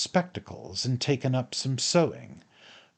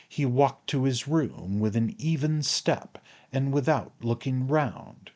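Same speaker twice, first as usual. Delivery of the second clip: plain reading voice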